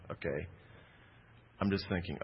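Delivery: ordinary talk, nothing marked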